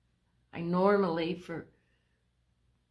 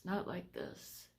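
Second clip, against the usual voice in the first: softer, slower repeat with "not" still leaned on; quiet slow echo; 9 dB quieter than usual. Real, not acted. Telling viewers this illness is worse, a soft slow echo. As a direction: let the words sink away, quiet and slow. Repeating how bad it feels.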